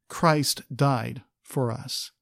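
ordinary delivery

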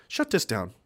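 a hint of a smug official ordering the shutdown; Walter Peck. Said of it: "Like Walter Peck from Ghostbusters".

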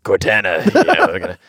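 low gravelly soldier voice; deep gravelly hero, lightly done; Master Chief voice. Retold or imitated. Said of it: "deep, gravelly voice".

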